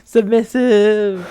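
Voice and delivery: loud, high, long-drawn word; slow, singsong triumphant call; gleeful crowing. Pretend. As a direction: stretch it out in a playful cheer. Cheering at the character's submission.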